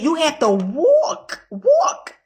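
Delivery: walk stretched slow, second walk leaps way up; emphatic "walk, walk"; stress on walk. Real, not acted. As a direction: draw out "walk" then fling it high. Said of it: "stretched emphatic walk".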